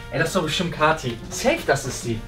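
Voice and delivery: normal talking voice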